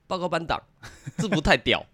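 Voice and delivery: lisping "制服太屌", high and loud; 大舌头. Retold or imitated. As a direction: say "太屌" with a lisp, tongue too short. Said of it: "他讲话都大舌头这样子". (大舌头口齿不清地说"制服太屌")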